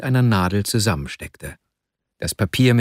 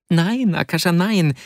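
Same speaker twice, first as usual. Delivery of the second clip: emotional protest; 6 semitones higher than usual. A narrator's reading.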